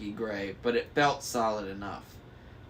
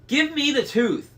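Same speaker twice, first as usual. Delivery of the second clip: very high, loud crone demand; demanding hag; 14 semitones higher, 6 dB louder than usual. A narrator's reading. Sister demanding the tooth. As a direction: screech for the tooth.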